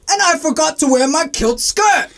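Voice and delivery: high, hammed-up Scottish punchline; Scotsman voice. Joke teller lands punchline as Scottish co-pilot, high hammed-up accent. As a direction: belt out a jokey Scotsman's line. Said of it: "the Scotsman is like".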